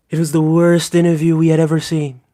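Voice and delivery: lightly voiced Trump impression, leaning on worst; a hint of Trump, worst stretched and falling. Streamer tries his Trump accent on viewers, lightly voiced. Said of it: "a brilliant Trump accent".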